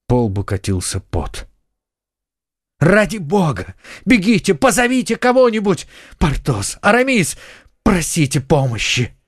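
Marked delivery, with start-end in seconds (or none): 2.8-9.2 high-pitched, panicked calls for help; urgent, imploring cries to friends; frantic plea. A narrator's reading. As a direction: shout orders desperately, voice leaping up. Hero begs friends to fetch help.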